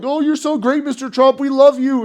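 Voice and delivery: high gushing suck-up voice; fawning voice. Retold or imitated. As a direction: gush like a fawning fan. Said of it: "people around them were like".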